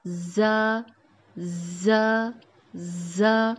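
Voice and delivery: زا with a firm start and a long, whistling buzz of ز. Shown as a demonstration. Teacher models the buzzing زا, firm and whistling. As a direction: stretch the ز buzz before the vowel. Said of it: "ز کی آواز نکال کے اسی کو ایکسٹینڈ کریں".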